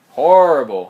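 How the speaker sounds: loud disgusted final word; emphatic outburst. Real, not acted. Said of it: "Horrible, horrible movie".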